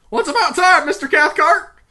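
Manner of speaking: high, loud barking squawk; comically squeaky, loud dog-like bark; constipated poodle voice. Acted. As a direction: yap the greeting like a strained poodle. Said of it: "barking like a constipated poodle".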